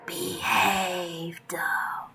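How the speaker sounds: sinister breathy whisper of a mirror ghost; whispered threat from the reflection; creepy whisper. Acted. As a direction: menace her in a whisper. Reflection threatens Dove up close.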